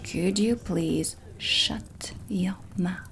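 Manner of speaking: ordinary talk, nothing marked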